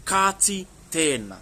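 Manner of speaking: slow "Ka ti tēnā" with stretched vowels; long vowels. Shown as a demonstration. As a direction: model "Ka ti tēnā" for repeating, vowels held long. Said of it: "some quite long vowel sounds in there".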